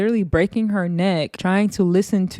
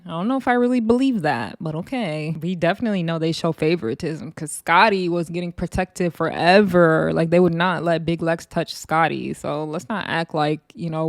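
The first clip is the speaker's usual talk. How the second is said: normal talking voice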